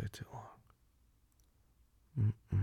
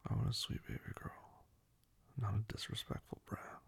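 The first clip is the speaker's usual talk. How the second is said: soft scolding whisper; 7 dB quieter than usual. Acted. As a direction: whisper a gentle warning.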